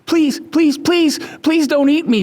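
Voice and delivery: high squeaky pleading mouse; begging mouse. Acted. Mouse begging the lion not to eat him, high pleading voice. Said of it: "begged the mouse".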